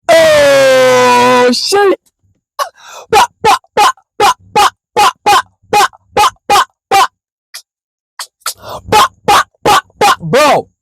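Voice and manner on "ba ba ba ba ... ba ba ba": beatbox imitation. Mimicking the beat while reacting.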